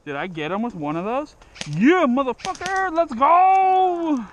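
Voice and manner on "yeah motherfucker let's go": triumphant yell. Real, not acted. Celebrating a kill shot.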